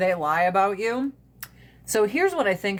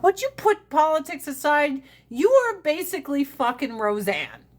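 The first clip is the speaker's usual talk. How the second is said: exasperated; 7 semitones higher than usual. Retold or imitated. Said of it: "And I'm like".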